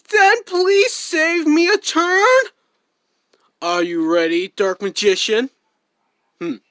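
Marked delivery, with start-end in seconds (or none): 0.1-2.4 put-on pleading kid voice. Younger duelist pleads to go.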